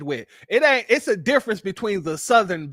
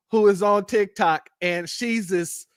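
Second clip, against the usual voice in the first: ordinary talk, nothing marked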